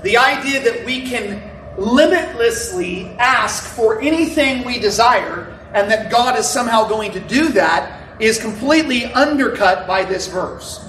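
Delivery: ordinary talk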